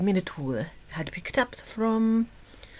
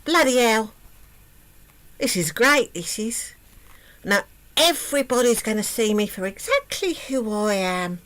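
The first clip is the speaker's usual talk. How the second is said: gloating princess; 3 semitones higher, 4 dB louder than usual. Acted. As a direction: crow over the robe like a spoilt brat. Princess gloats in new robe.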